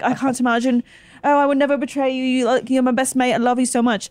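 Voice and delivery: lightly imitated gushy reality star; gushing contestant. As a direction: play a fake-sweet UK contestant. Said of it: "they're like".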